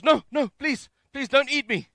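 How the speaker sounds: high, loud mock begging; panicked pleading. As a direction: plead like a joke mouse about to be eaten. Mouse in joke begs snake.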